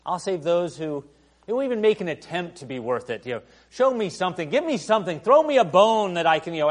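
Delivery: loud, high, pushy demand; hammed-up transactional voice; mock bargaining God. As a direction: haggle like a stingy boss.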